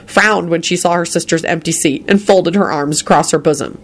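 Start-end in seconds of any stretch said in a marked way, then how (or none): none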